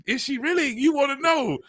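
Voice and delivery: high, excited questioning; eager disbelief. Retold or imitated. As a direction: pitch it way up, all curious. Mock eager listener asking.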